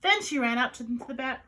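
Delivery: ordinary delivery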